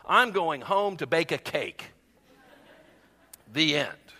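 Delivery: measured storybook narration with lively character lines; animated read-aloud; children's story reading. Acted. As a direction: read it like a picture book, phrase by phrase.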